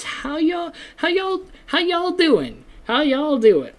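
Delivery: high, twangy Southern US greeting, put on; mock Southern accent. Host greets listeners in a joking Southern US accent. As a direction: play a hammed-up Southern US drawl on y'all. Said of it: "I'm not even from the South".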